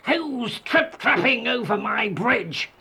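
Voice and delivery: troll challenges the goat on his bridge, high drawn-out roar. A narrator's reading. Troll to youngest goat on the bridge, roaring. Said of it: "roared the troll".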